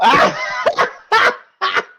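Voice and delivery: very high, loud burst of laughter; real cackle. Real, not acted. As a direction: crack up at your own punchline. Laughs at the punchline. (carcajada fuerte y aguda)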